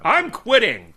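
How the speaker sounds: high, loud mock huff; mock actor quitting. Actor quitting the show, mock shouted high and loud. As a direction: storm off in a hammed-up huff.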